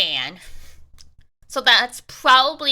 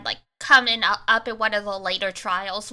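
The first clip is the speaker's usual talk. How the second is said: ordinary talk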